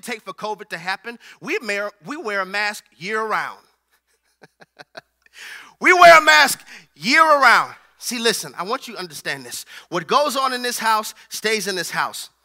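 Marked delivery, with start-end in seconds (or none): 5.8-7.7 louder, higher punchline; emphatic preached repeat. Shown as a demonstration. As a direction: hammer it home to the congregation.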